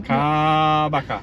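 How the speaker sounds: Kabaka with a long, loud first Kaaa; drawn-out mangled name. Shown as a demonstration. Husband clowning with the falls' name, drawn-out first syllable.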